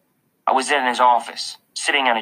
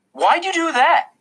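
high, louder, drawn-out whine; indignant complaint; 5 dB louder than usual. A narrator's reading. Julian catching up to a classmate, indignant.

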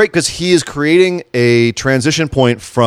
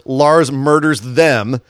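slow dramatic; much slower than usual. Acted.

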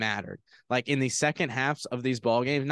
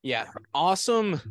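ordinary talk, nothing marked

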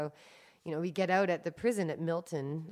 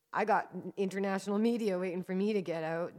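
ordinary talk, nothing marked

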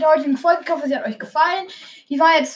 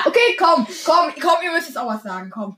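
urging; 2 semitones higher, 3 dB louder than usual. Real, not acted. Podcaster urging siblings to say something, calling out. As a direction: call out insistently to get them over.

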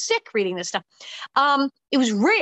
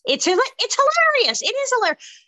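delighted; 13 semitones higher, 5 dB louder than usual. Real, not acted. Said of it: "laughing about the script".

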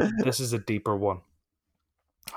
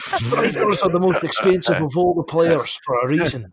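higher, louder hammed-up Scottish accent; 6 semitones higher, 5 dB louder than usual. Repeating the Souness quote for the lads, in a hammed-up Scottish accent. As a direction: play the Scottish pundit in full, a bit louder. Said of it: "You're gonna love my Scottish accent".

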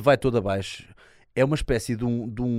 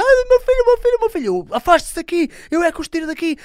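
shrill, much higher, louder hysterical cries; panicked parent screaming; 9 dB louder than usual. Retold or imitated. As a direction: parent shrieking for their child. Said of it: "vêm os pais aos gritos". (pais aos gritos desesperados)

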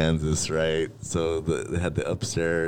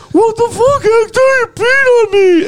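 outraged yell; 9 dB louder than usual. Retold or imitated. Fernie yells at Hector after being pissed on, an outraged yell. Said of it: "and he's like".

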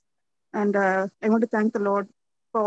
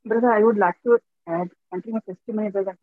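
radio breaking up; faster than usual. Real, not acted. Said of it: "your voice is coming in quite".

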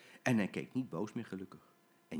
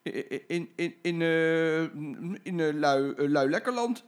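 nervous stammer; 7 semitones higher, 6 dB louder than usual. Acted. Jim stammers caught out.

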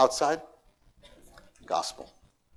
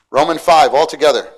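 calling out; 4 semitones higher, 13 dB louder than usual. Real, not acted. Calls congregation to read.

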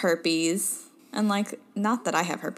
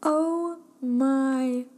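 slow stunned oh my God; shocked, slow gasp; 4 semitones higher, much slower than usual. Real, not acted. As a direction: drag it out in disbelief. Shocked reaction to herpes news.

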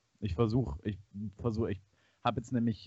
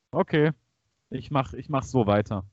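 louder; 9 dB louder than usual. Real, not acted. Testing louder mic level.

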